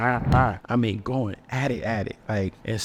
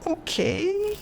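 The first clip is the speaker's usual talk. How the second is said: squeaky stunned okay. Retold or imitated. Reacting in stunned disbelief.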